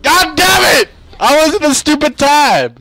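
high, loud angry shout; frustrated outburst. Real, not acted. Player cursing after dying in the game, frustrated shout. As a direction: yell in exasperation at the game.

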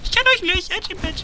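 high mocking voice. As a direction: taunt them in a squeaky voice. Taunting enemies in-game.

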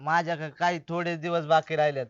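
low, hushed sorrow; subdued and doleful; grave confession. Acted. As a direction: break bad news softly. Man says few days remain.

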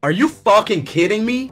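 can't believe he was pranked; pranked streamer reacting in shocked disbelief; stunned disbelief. Real, not acted.

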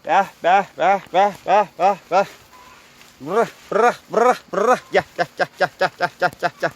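high, loud repeated animal call; rolled "brah" calls, then rapid come-here calls; rhythmic high-pitched summons to livestock; animal herding call. Real, not acted.

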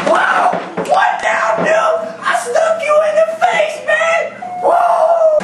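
shrieking high-pitched yelling; gamer rage shout. Acted. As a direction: scream at the game like a sore winner. Gaming rant on Halo.

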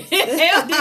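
loud, high laughing exclamation. Real, not acted. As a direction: laugh through the words. Laughing at friend's remark.

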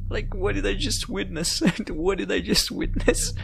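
incredulous, with real laughs between phrases; amused disbelief. Real, not acted. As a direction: ask it while recovering from a laughing fit. Still laughing at what happened.

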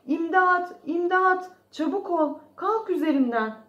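high, loud, repeated shouts; urgent cry for help. A narrator's reading. Trapped creature yelling at the mouse to get off, urgent shouts. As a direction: yell urgently to be freed. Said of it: "diye bağıran kişi".

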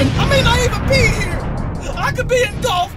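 high, rattled and overwhelmed; shaken disbelief. Real, not acted. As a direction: voice up high in shock. Shock after truck hit car.

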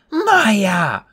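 high-pitched urgent yell. A narrator's reading. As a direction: yell it like a command on the run.

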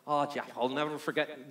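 light attempt at a Newfie accent; [name] in Newfie accent. Woman recalling an Eli story to Jeff, mild Newfie accent. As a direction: put on a slight, imperfect Newfie lilt. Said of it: "the little Newfie accent".

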